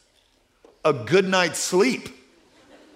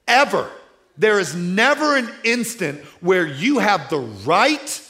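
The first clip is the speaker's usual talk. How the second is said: forceful preaching; 5 semitones higher, 7 dB louder than usual. Acted. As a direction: hammer the point home to the men in the pews.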